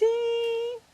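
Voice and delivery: very high playful squeak. Acted. Owner teasing her parrot in a very high squeak. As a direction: squeak it up like talking to a pet.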